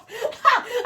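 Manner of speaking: real burst of high laughter. Real, not acted. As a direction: break up laughing. Laughing at the quote.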